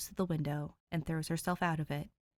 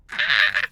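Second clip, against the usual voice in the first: excited squeal; 10 semitones lower, 12 dB louder than usual. Real, not acted. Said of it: "so excited".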